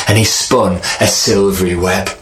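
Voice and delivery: usual speaking voice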